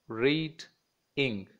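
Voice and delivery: "read", a gap, then "ing"; teacher breaking reading into "read, ing"; syllable by syllable. Shown as a demonstration. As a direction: sound out "read" and "ing" as two separate pieces. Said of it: "has two syllables".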